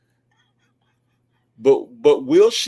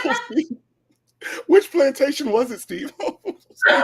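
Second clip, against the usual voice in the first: high, swooning mock co-host; Shirley Strawberry impression; 11 semitones higher than usual. Co-host gushing over the host, high mock swoon. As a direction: coo his name like an adoring fan. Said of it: "And Shirley be".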